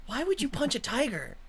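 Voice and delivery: high-pitched heckler in the crowd; audience member. Acted. Game master as a spectator at the tiger fight, high and incredulous. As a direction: pitch it up as a baffled onlooker. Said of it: "You hear one of them go".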